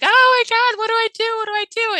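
high, loud, panicked squeal; imitating her own frantic phone call. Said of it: "like, all crazy".

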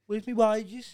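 high, loud put-on Birmingham whine; a hammed-up Brummie asking for his pay; mock Birmingham accent. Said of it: "always sound like they're saying sorry".

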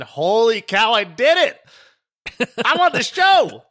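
loud, high victory cry; excited shout. Real, not acted. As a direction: yell it out in triumph. Celebrating joining the show.

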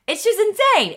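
bursting, high and loud on insane; gushing with real delight; excited. Real, not acted. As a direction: throws the word insane up high. Gushing about her podcast.

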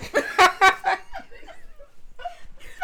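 a high, loud burst of laughter; laughing hard at the priest story. Real, not acted. Laughing at her own story.

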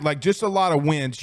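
ordinary talk, nothing marked

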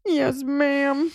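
put-on high, drawn-out child whimper; squeaky tearful-kid imitation; mock crying. Reprise of scolded little brother, mock crying. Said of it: "he was crying".

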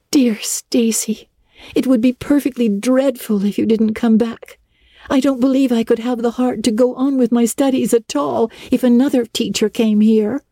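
put-on high, earnest young girl voice, starting whispered; a heartfelt schoolgirl pleading; eager girl Anne. A narrator's reading. Anne begs her teacher to come back, earnest and high.

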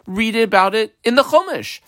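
high, dismissive retort; incredulous objection. Acted. Student sending the questioner to the Chumash, incredulous.

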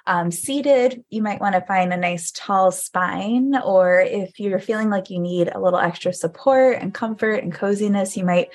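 gentle meditation guide inviting listeners to get cozy; guided meditation. Acted. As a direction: ease listeners into settling down.

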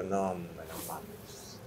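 retelling his hushed, stunned reaction to the news; stunned disbelief. Retold or imitated. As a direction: let it drain out of you in shock. Retold reaction to death news.

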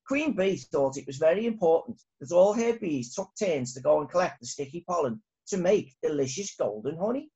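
ordinary delivery, nothing marked

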